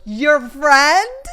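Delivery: loud, rising mock-offended question; incredulous. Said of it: "of course, Carter's like".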